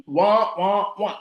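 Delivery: mock sad-trombone wah, wah, wah; losing-buzzer wah-wah. Coach reacts to Barbara being busy, mock losing noise. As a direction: sound out a cartoon letdown. Imitates a losing sound effect.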